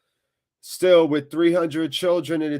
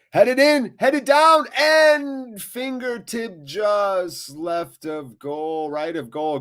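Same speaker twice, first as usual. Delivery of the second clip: much higher and louder, calling the near goal; excited shout; 9 semitones higher, 5 dB louder, much slower than usual. Real, not acted. Fan calling a near miss live, excited shout. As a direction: yell it as the ball nearly goes in.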